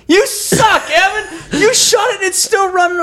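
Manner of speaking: high-pitched, exasperated yell; heckling shout. Retold or imitated. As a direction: re-enact yelling abuse at a hunting buddy. Acting out heckling his friend.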